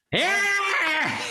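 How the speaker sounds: mock umpire yelling the runner out; umpire's call. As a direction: bark it like an umpire. Umpire ringing him up.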